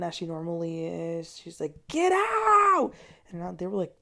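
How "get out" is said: high, loud drawn-out yell; high-pitched retold shriek; imitated scream. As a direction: imitate someone screaming them out the door. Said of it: "Tamara was just scre-, ... being so violent".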